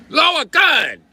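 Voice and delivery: very high, loud hammed-up shout; mock shout. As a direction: bark the order with a mock accent. Acted standoff shouting.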